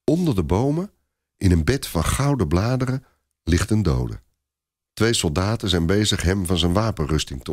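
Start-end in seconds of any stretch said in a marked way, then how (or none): none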